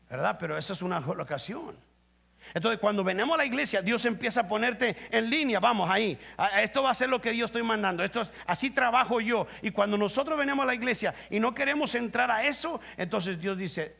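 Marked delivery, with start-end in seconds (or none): 2.5-12.8 full-force preaching. Acted.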